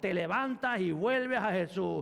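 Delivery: building preaching. Acted. Pastor urging sinners back to Jesus, rising preaching. Building altar call climax.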